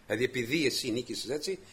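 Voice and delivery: ordinary delivery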